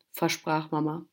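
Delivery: usual speaking voice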